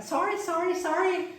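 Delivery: high-pitched, repeated puzzled "sorry"; uncomprehending foreigner. Retold or imitated. Gringo not understanding the learner, imitated high-pitched querying. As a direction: imitate a baffled English speaker, pitched high. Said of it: "é o gringo ficar".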